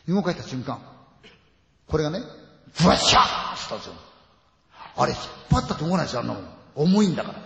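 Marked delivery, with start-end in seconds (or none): 2.8-3.6 crash noise. Retold or imitated. Imitating crashing lights.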